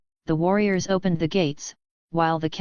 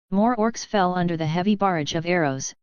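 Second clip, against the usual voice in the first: plain reading voice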